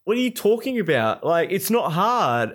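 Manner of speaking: a hint of the smug teammate; smug teammate brushing off complaints, dismissive; imitating teammate. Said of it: "And he goes".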